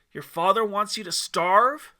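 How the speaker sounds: mocking taunt. Lucifer mocks the starving man, loud and scornful. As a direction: sneer it with disbelief.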